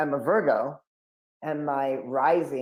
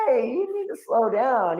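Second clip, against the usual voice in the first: dad's warning; 9 semitones higher, 4 dB louder than usual. Retold or imitated. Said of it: "he's like".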